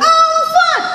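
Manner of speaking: squeaky high falsetto; mock a tiny soprano voice; high-pitched soprano voice of a lady in waist trainers. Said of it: "some high-pitched soprano voice".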